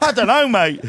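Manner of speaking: loud, high exasperated retort. Retold or imitated. Host to a neighbour at the gate, exasperated retort. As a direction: fire it back high and loud.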